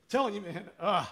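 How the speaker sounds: real tears breaking into a laugh; choked up, crying. Real, not acted. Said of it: "why am I crying about a trailer".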